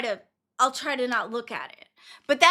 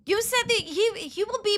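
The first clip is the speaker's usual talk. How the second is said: mock snap; 7 semitones higher than usual. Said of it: "I'm like".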